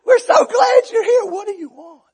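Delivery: high, loud greeting that falls off; put-on over-eager hospitality; mock gushing welcome. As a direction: imitate insincere hosts. Hosts fake welcome to preacher.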